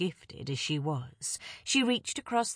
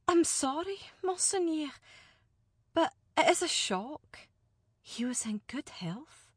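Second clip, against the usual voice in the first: higher, shaken noblewoman; shocked lady; 9 semitones higher than usual. Acted. Alina apologizing to the envoy, shaken. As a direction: hold composure through grief.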